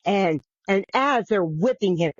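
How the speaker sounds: ordinary talk, nothing marked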